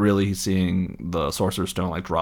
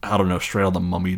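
usual speaking voice

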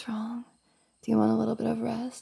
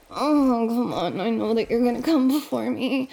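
louder moaning urgency; breathless moaning; 5 dB louder than usual. Acted. Roommate racing him to climax, moaning. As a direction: moan it out, close to the edge.

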